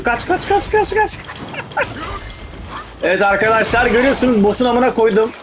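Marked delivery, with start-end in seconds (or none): 0.0-1.2 high panicked repeated urging; frantic urging. Real, not acted. Panicked urging to dodge attack. 1.2-3.0 airy, high, wheezing laugh; breathless laugh. Real, not acted. Laughs after boss fight.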